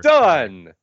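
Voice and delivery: sudden loud declaration; loud exclamation. Real, not acted. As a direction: slam it down like a verdict. Punchline exclamation.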